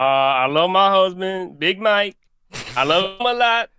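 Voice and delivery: slow, measured presidential impression; Barack Obama. Shown as a demonstration. Caller performing on request, slow Obama impression. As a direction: drag the words out as the former president. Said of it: "in Obama's voice".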